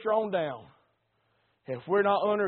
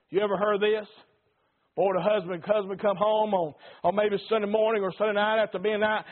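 normal talking voice

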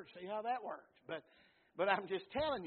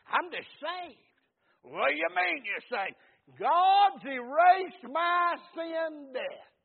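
high, slow preacher's shout swelling louder; full-force preaching; 10 semitones higher, 15 dB louder, much slower than usual. Real, not acted. Preacher testifies about being saved, full-force preaching. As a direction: cry it out with long-held words.

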